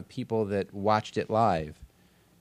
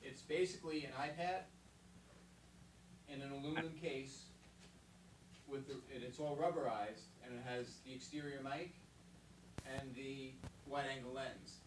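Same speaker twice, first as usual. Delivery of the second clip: distant, off-mic voice; talking from across the room; far from mic; 4 semitones higher, 18 dB quieter than usual. Real, not acted. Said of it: "I'm just gonna step away from the mic".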